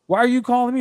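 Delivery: high, indignant comeback; indignant retort. Retold or imitated. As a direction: throw the question right back at him. Retorts to caller, raised voice.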